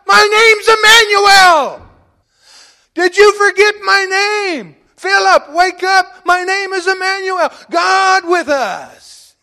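shouted, high and slow; voicing Jesus, full pulpit shout; Jesus calling out. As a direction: shake him awake with his own name. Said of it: "Jesus is saying".

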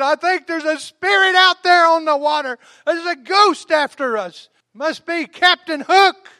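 high, loud, slow spooked cry; mock frightened disciples. Disciples in the boat cry out in fear of a ghost. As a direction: play scared sailors seeing a ghost.

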